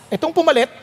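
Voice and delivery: usual speaking voice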